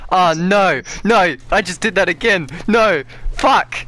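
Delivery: loud, high frustrated yelling; real exasperated groan at own mistake; frustrated outburst. Real, not acted. Botches bunker seal again.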